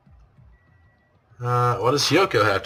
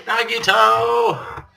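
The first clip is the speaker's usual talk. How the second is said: very high, very slow stretched call; drawn-out call; 11 semitones higher, 4 dB louder, much slower than usual. Acted. Calling to Nagito for comfort, long and high. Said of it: "I don't feel very hopeful".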